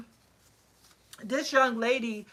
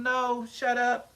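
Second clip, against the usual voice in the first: dismissive; slower than usual. Retold or imitated. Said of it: "And I told him".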